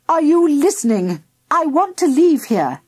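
high, upset demand; demanding wife. A narrator's reading. Wife demanding husband's attention.

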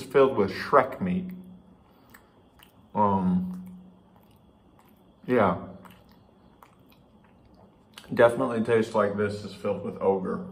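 chewing while talking; speaking around food; mouth full. Real, not acted. Seen 1: Food visible in open mouth at 0.2; bun held at lips throughout.

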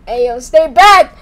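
normal talking voice

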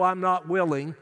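ordinary talk

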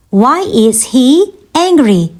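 measured word-by-word question; slow teaching repeat. Shown as a demonstration. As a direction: break it up for learners. Teacher repeats question slowly for learners.

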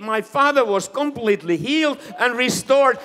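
loud, high, jubilant preaching. Acted. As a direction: proclaim the miracle triumphantly. Preacher rising to full force.

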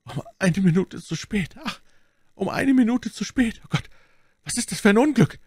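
high-pitched, anguished lament; despairing moan. A narrator's reading. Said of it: "raufte sich die Haare und stöhnte". (verzweifelt stöhnend)

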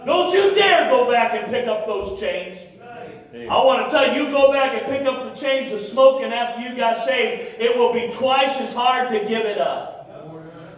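high, emphatic pulpit exhortation; fiery preaching. Real, not acted. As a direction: drive each phrase home to the congregation. Preaching at full force.

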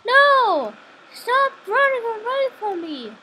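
shouting high and loud; yelling across the room. Real, not acted. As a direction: yell at the fleeing enemy. Chasing enemy, yelling at him.

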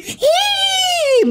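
high shrieking hee; high squeal. Real, not acted. Reacting to a fine woman, high shriek. Lusting over woman in clip.

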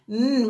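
"Mm" hummed as a long nasal mm; the n sound. Shown as a demonstration. Teacher modelling the letter N sound "Mm" for the class. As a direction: hum "Mm" for the n sound, lips closed. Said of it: "Do you hear the sound?".

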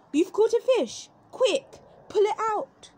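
high, loud, urgent calling. A narrator's reading. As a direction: shout it up high, all excited. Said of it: "The fox called out".

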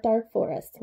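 ordinary delivery

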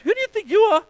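high, loud, scornful rebuke; shaming disciples. Acted. Disciples chase off the boy with his lunch, scornfully. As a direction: scoff and send him packing. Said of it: "the disciples would have laid shame on that little guy".

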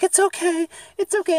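very high, loud cooing mock voice; soothing dog owner imitation. Imitating a woman calming her lunging dog, high mock soothing. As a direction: pitch it way up, mock-reassuring. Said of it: "She's like".

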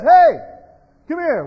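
loud, high summoning call; commanding shout to come over; God calling out. Acted. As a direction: call an angel over sharply. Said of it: "When God says".

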